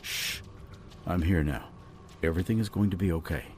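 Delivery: soft, low, hushing reassurance; calm her gently in a low, quiet voice; soothing comfort. A narrator's reading. Chris holds and comforts sobbing Jade.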